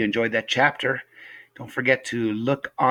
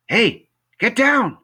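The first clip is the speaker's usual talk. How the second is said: high, loud, drawn-out command; urgent high-pitched boy's yell; calling out; 7 dB louder, slower than usual. Acted. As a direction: shout at a misbehaving pet. Boy orders cat off counter.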